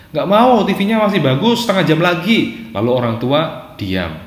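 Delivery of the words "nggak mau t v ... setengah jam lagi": put-on high-pitched child's refusal; protesting child. Andi refusing his parent's bedtime order, high protesting child. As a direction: act a kid protesting, pitched well up. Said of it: "Lalu Andi jawab".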